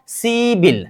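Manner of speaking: "सिबिल" as a long see, then bil; correct pronunciation. Shown as a demonstration. Teacher models the changed sound for students, "सिबिल" pronounced with b. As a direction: say "सिबिल" with b in place of p. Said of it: "उच्चारण कीजिए".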